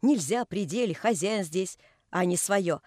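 ordinary delivery, nothing marked

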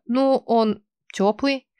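медленно и тише, растерянно; ляпни первое, что пришло в голову; ляпнула. Acted. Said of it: "ляпнула первое, что пришло". (растерянно ляпнула)

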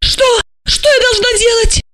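very high, helpless plea; tearful question. Acted. Asya begs Gorokhov for instructions, high and tearful. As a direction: ask helplessly, pitched right up.

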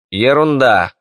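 loud, high brush-off; dismissive. Acted. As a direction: wave it away. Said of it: "отмахнулся император". (пренебрежительно отмахнуться)

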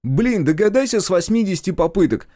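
high, loud, fed-up brother's voice; exasperated outburst. Acted. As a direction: snap it out, out of patience. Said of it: "Не выдержал Колян". (раздражённый выкрик на высоком голосе)

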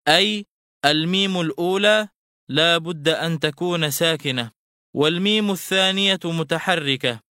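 ordinary talk, nothing marked

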